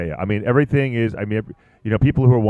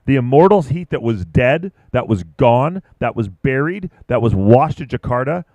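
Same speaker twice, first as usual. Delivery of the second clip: big booming build, dragging out the phoenix line; rally the crowd like a fight-night announcer; hype announcer; 4 semitones higher, 5 dB louder than usual. Acted. Announcer hyping the returning Immortals heat, booming build.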